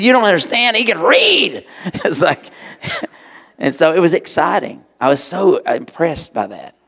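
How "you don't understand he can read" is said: much higher, louder excited protest; screaming at teacher. Retold or imitated. Boy telling the shushing teacher, high excited shout. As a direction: burst out to the teacher. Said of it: "screaming at the teacher".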